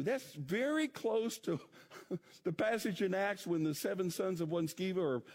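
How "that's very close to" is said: ordinary talk, nothing marked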